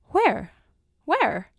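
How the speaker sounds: high, pressing cry; urgent questioning. A narrator's reading. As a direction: demand an answer, bewildered. Family demands where his home is.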